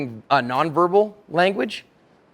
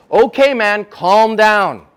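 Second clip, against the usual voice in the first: loud, raised-pitch command; bossy, louder telling-off; wrong way to de-escalate; 4 semitones higher, 7 dB louder, much slower than usual. Acted. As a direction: order them to settle down, too forcefully. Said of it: "Now, you don't say".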